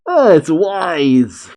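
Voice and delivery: high, drawn-out mock shout; stretched-out exclamation of surprise. A narrator's reading. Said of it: "Shiki shouts for Wise".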